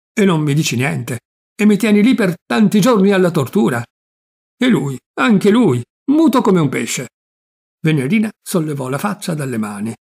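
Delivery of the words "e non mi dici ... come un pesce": louder and higher, flying into a rage; an uncle erupting at being kept in the dark; furious. A narrator's reading. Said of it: "montò su tutte le furie".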